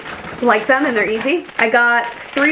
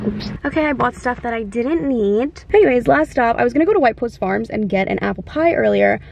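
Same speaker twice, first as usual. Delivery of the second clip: ordinary delivery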